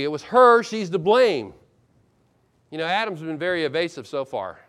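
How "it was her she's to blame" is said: "her" punched high and loud; emphatic blame. Acted. Adam blaming his wife, "her" stressed high and loud. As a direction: throw the blame hard onto "her". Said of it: "Adam puts the emphasis on the woman".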